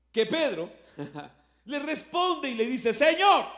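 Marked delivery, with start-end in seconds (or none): 2.9-3.5 calling out. Acted. Peter calling to Jesus.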